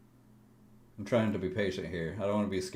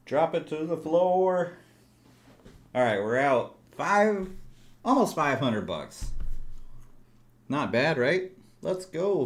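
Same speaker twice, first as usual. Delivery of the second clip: higher, louder, thrilled; excited; 7 semitones higher, 6 dB louder than usual. Real, not acted. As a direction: celebrate the win out loud. Celebrating winning trade.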